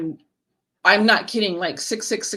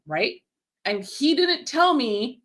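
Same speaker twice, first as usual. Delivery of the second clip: usual speaking voice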